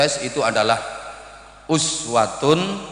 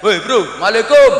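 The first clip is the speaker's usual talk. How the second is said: loud, high casual shout; buddy street greeting; 8 semitones higher, 8 dB louder than usual. Acted. Meeting a friend in the street, boisterous shouted greeting. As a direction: holler hello to a pal. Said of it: "Ketemu temen di tengah jalan".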